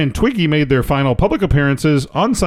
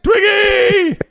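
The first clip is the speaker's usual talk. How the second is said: high shout calling a pet. Acted. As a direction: call her name across the pool. Calling the squirrel.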